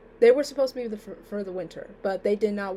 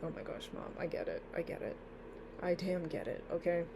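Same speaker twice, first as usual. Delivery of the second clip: exasperated mutter; 3 semitones lower, 11 dB quieter than usual. Retold or imitated. Exasperated reply to nagging mom.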